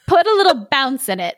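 laughing ogre voice. Acted. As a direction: demand it with a chuckle. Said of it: "said the ogre".

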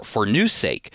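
usual speaking voice

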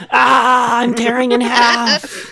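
strained gene voice. Pretend. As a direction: ham up a gene being ripped in half. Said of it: "your genes are going".

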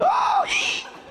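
high shriek on being stabbed; sharp mock death screech; speared goblin cry. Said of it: "and then get speared".

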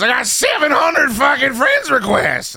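high, slow disbelieving yell; exasperated shout. Retold or imitated. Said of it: "He goes".

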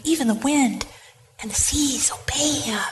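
hushed, breathless wonder; awed whisper. Real, not acted. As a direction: whisper it in amazement. Awed aside.

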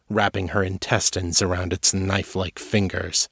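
plain reading voice

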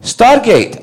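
very high questioning echo of the name; incredulous dad. Retold or imitated. Dad hearing his son's show name, incredulous.

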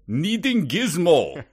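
slow broken English, mock Arabic accent; thick Arabic accent. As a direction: drag it out in a heavy Arabic accent. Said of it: "thick Arabic accent".

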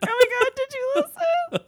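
very high excited squeal; squealing excitement. Retold or imitated. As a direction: squeal like happy crying. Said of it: "texting each other like".

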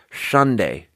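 "Sunday" with a slushy SH for the S; lisped S. Shown as a demonstration. Showing tongue too far up, lisped S. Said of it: "that turns into a lisp".

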